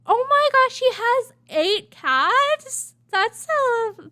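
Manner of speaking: high, loud, drawn-out disbelief; real astonishment at so many cats; amazed outburst. Real, not acted. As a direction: marvel loudly, stretching the words.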